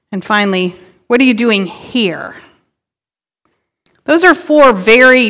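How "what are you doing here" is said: HERE set apart and emphasized at the end; stress on HERE. Shown as a demonstration. Preacher shows congregation how emphasis changes the question, stress on HERE. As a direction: run up to "here" and land on it. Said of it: "depending on what word you emphasize".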